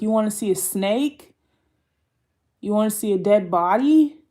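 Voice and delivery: a hint of a creepy enticer; mock predator's lure. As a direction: pitch it loudly as a tempting offer. Acts predator card luring a child.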